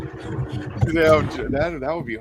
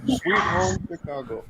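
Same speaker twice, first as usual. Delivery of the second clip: mock John Belushi; 6 semitones higher, slower than usual. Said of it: "she wants a Scottish John Belushi".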